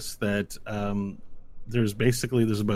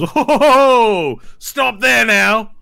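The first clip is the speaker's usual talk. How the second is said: loud, high, slow commanding knight; booming knight; 9 semitones higher, 7 dB louder, slower than usual. Acted. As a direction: bellow it from horseback like a proud captain. GM voices mounted knight.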